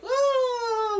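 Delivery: high mock shriek of a transforming witch; short shrill mock cry; witchy shriek. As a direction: shriek like a witch losing her form.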